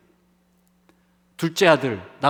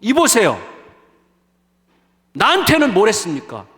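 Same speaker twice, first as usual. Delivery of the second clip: acted indignant challenge, higher and louder; accusing demand; 4 semitones higher, 4 dB louder than usual. Resentful elder son to his father, accusing demand. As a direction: confront him as if owed an answer. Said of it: "이렇게 따지는 거예요".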